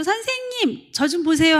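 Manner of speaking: put-on high childish call to the teacher; squeaky kid voice tugging for attention. Child eagerly calling the teacher.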